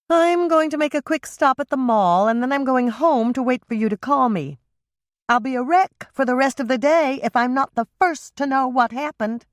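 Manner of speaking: put-on fretting older sister; anxious sister Andrea. A narrator's reading. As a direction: sister who will worry until she hears news. Andrea voiced higher.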